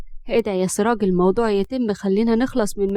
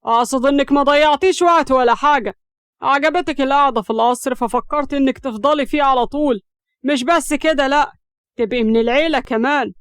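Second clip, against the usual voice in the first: raised, heated accusation; irritated; 3 semitones higher, 4 dB louder than usual. A narrator's reading. Siraj accuses Hams of scheming to stay, irritated. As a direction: accuse her in an agitated voice. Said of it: "واتكلم بعصبية".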